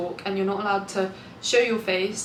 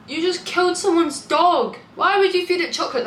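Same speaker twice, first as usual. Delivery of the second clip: scandalized and appalled; outraged; 8 semitones higher, 5 dB louder than usual. Real, not acted. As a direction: gasp then high, loud outrage. Outrage at dog death.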